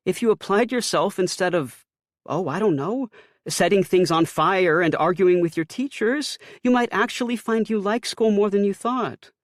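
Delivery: high, loud nagging mother; Ma lecturing. A narrator's reading. Mother lecturing her son about school. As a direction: needle him as Mom, voiced high.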